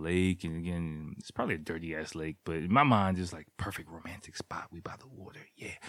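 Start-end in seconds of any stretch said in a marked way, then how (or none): 3.2-5.7 breathy whisper, setting the mood; hushed whisper. Retold or imitated. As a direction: whisper as if not to break the moment. Romantic spot, hushed.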